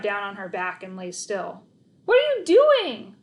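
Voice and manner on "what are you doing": very high, loud slow screech; shrieking at the dog. Acted. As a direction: screech in disbelief. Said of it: "I screeched".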